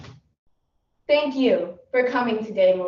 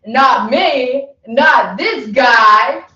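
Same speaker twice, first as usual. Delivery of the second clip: cocky brush-off; 8 dB louder, much slower than usual. Retold or imitated. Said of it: "I was like".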